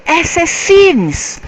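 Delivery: loud, high, drawn-out exclamation; high-pitched excited cry; blurted out. A narrator's reading. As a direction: blurt it out in sudden realization. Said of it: "Matthew blurted out".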